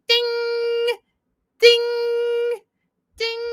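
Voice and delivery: high, drawn-out ringing "ding"; sing-out high bell imitation; imitated phone ding. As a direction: mimic a timer chime, high and sustained. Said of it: "all those dings going off".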